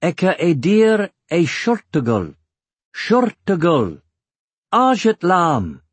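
high, deliberate foreign words, each one weighted; slow and emphatic. A narrator's reading. Eragon introduces himself to dwarf children in Dwarvish, slow and emphatic. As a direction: announce each word slowly and clearly. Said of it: "speaking slowly and emphasizing the words".

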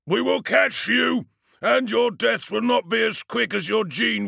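slow, high, booming threat. Acted. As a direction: vow vengeance in a huge slow roar. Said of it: "A booming voice echoed ... full of indignant anger".

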